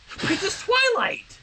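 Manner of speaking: high, slow, stunned exclamation; croaked in shock. Acted. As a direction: gasp the name out, mouth gone dry. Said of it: "Sunset croaked".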